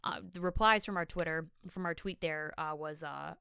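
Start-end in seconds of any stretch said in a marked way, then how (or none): none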